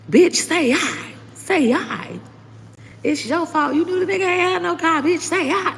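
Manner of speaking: imitating a friend who won't take excuses; blunt scolding friend. Friend Love scolds her on Facebook, high sassy mock reprimand. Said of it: "and Love say".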